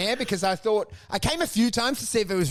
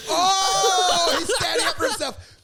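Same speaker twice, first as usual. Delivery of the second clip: high mocking crow, loud drawn-out "oh"; mock the victim like a bully gang; hammed-up taunting with laughter; jeering bullies; 12 semitones higher, 4 dB louder, slower than usual. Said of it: "And then they're like".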